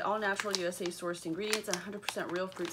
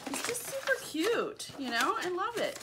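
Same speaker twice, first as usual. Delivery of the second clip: excited; 10 semitones higher than usual. Real, not acted. Gushing over the box design.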